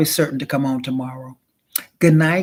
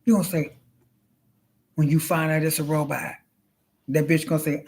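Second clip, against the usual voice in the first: usual speaking voice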